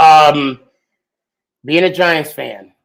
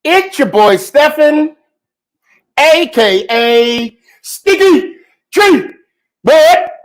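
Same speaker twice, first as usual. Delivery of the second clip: hype greeting; 10 semitones higher, 4 dB louder, slower than usual. Real, not acted. Host opens show with hype.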